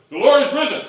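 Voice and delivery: loud, high-pitched proclamation; shouted greeting. Acted. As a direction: shout it out to someone across the crowd. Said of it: "one person shouting to another".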